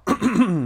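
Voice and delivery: clearing a scratchy throat. Real, not acted. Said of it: "losing my voice immediately".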